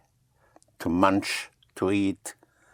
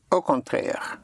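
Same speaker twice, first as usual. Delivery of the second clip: echoed French flourish; 6 semitones higher, slower than usual. Shown as a demonstration. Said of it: "They like to say that".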